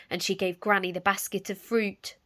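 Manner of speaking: usual speaking voice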